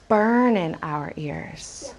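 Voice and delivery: slow, low "Burn in" for burning, no g; dropped g. Shown as a demonstration. Teacher having children listen, slow dropped-g "Burn in". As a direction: stretch "Burn in" slowly without the g. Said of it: "sounds like what we just talked about".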